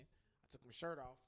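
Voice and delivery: ordinary delivery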